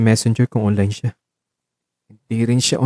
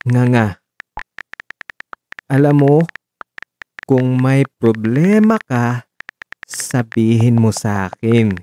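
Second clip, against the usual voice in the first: dictating; slower than usual. Acted. Dictating pleading message.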